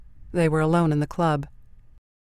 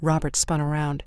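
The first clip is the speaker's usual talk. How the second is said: plain reading voice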